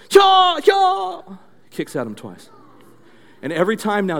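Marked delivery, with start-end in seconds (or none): 0.1-1.2 high, loud double kiai; shouted fighting cries; karate attack cries. Shown as a demonstration. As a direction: yell two explosive martial arts strikes. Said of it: "It was like this".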